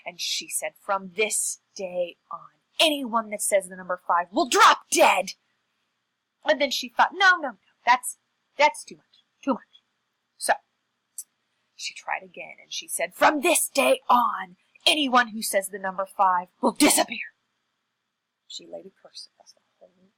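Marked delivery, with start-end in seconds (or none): 0.8-5.3 slow solemn start, punched-out ending; pronouncing a curse. Acted. Witch-like woman pronounces a curse. 7.1-9.8 louder, higher self-correction easing off; hasty backtracking. Acted. Curser takes back her words. 13.2-17.3 pronouncing a curse. Acted. Curse pronounced a second time.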